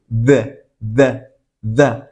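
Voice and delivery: "d" as short, separate voiced taps; isolated D sound. Shown as a demonstration. Teacher has students repeat the sound, "d" short and voiced. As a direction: say "d" alone, voiced, tongue to the teeth. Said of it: "va a sonar como una D".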